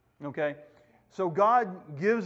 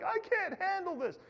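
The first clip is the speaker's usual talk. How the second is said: high-pitched, softer helpless squeal; mock overwhelmed; 12 semitones higher, 6 dB quieter than usual. Person stuck in a bad situation, mock-overwhelmed squeal. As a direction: squeak it out as if it's too much. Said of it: "you're like".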